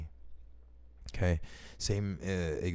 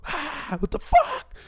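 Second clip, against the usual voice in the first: a shrill, much higher, louder shriek of panic; high-pitched squeal; 8 dB louder, slower than usual. Retold or imitated. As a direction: squeal it like a lizard just ran out of your slipper. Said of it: "I start squealing like a bitch".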